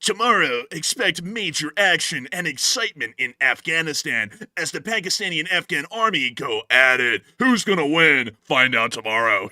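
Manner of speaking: loud dramatic trailer voiceover; trailer announcer. Pretend. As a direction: sell it like an action movie trailer. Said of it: "we're giving a trailer".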